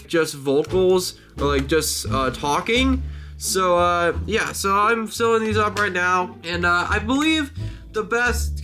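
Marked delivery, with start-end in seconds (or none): none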